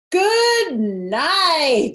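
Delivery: high, loud shriek of disgust; boy screaming at a stink. Acted. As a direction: yell it out, repulsed. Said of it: "screamed Avery".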